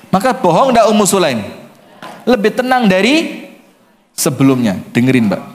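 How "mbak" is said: ordinary talk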